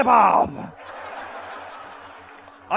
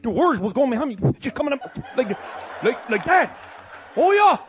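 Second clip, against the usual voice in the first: usual speaking voice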